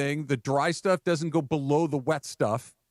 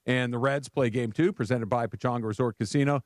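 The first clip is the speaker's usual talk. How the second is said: promo announcer; 2 semitones lower than usual. Acted. Reads show promo.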